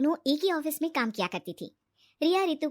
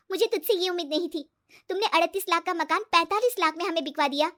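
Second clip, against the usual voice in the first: higher-voiced accusing friend; hurt reproach; 5 semitones higher, 4 dB louder than usual. Riya confronting Ritu over the inflated price, hurt reproach. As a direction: confront a friend who let you down.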